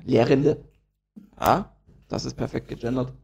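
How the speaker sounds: usual speaking voice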